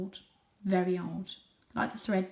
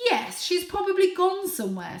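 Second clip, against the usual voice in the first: father cat voice; 11 semitones higher, 7 dB louder than usual. Acted. Father cat speaks in story.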